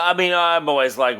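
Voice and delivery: ordinary delivery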